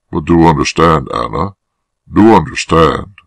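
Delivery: ordinary delivery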